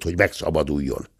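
usual speaking voice

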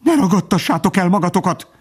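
high, panicky pheasant voice; alarmed bird losing control; frantic cry. A narrator's reading. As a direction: cry out shrilly high, pleading for composure. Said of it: "kiáltott a fácán".

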